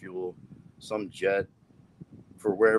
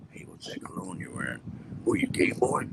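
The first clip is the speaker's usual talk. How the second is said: whispered mock question; 8 semitones lower, 3 dB quieter than usual. Imagined cologne question.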